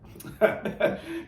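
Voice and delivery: a quick airless chuckle; breathy laugh. Real, not acted. Host chuckling at the fish pun, breathy laugh.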